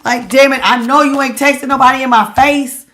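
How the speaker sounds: loud, high, fed-up rant at a cheating partner; exasperated outburst. Pretend. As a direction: blow up in disbelief, voice way up. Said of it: "you just like".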